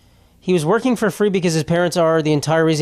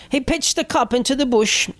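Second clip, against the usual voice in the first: higher, put-on British accent; mock British accent; 9 semitones higher than usual. Mocking the poster as British, British accent. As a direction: read it as a British lady. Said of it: "She's gotta be from the U K".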